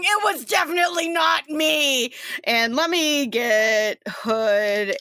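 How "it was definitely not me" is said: high mock protest shout; calling out in denial. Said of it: "You hear [name] going".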